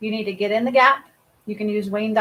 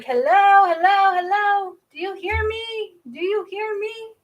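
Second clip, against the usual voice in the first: crow calling; 10 semitones higher than usual. Acted. Crow calling to the healer, high then fading. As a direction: call like a crow, then fade to a plea. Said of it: "the crow is like".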